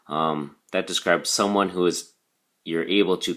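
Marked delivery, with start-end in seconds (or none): none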